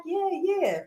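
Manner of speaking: breathy, spent agreement; a lightly voiced dreamy girlfriend; dazed girlfriend. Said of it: "She's like".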